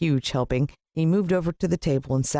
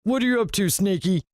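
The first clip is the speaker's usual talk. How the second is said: gloating villain; 8 semitones higher than usual. Acted. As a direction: croon to the pet snake, greedy and gleeful. Villain questions snake.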